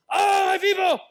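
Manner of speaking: high, loud acted outburst; exasperated cry. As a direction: cry out high, at wits' end. Voicing God's exasperated love.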